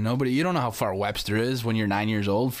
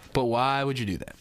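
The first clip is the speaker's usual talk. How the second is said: normal talking voice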